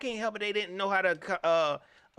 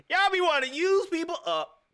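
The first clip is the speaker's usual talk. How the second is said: much higher and louder, teasing repeat; high mocking repeat; 13 semitones higher, 5 dB louder than usual. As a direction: throw the line back up high, mocking.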